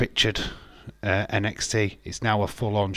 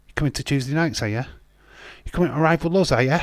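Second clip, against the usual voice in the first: raised-pitch taunting questions; mock challenge; 4 semitones higher, 3 dB louder, much faster than usual. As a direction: taunt the rival company, playing it up. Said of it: "That's a company what's just saying".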